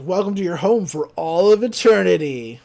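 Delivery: mock-ominous proclamation, swelling on eternity; ghost villain. Ghost traps the teens for eternity, mock ominous. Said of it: "And the ghost is like".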